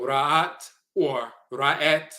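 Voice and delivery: slow and spaced, "Ra'at or Ra'et"; unhurried contrast of the vowel, "Ra'at" against "Ra'et"; slow demo. Shown as a demonstration. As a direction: draw out "Ra'at" then "Ra'et" slowly. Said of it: "different dialectical variants".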